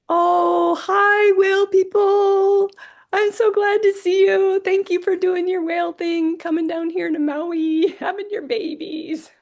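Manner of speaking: imitating Alan. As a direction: lift the voice way up and call hello to the whales. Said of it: "he's like".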